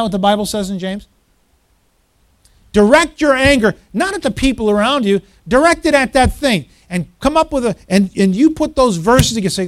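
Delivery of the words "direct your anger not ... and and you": loud, raised exhortation; full-force preaching. Real, not acted. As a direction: urge it forcefully on the congregation.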